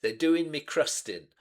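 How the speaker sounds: "They're doing me crustin'" with dropped g, a touch of Cockney; mock Cockney line. Savouring a favourite lyric in light mock Cockney. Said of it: "Cockney slang".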